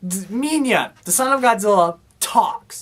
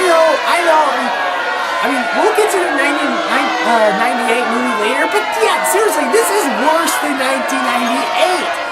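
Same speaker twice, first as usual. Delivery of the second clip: heated outburst; faster than usual. Real, not acted. Reviewer bracing for haters, shouting his defense. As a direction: insist over the critics before they object.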